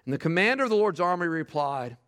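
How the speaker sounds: ordinary talk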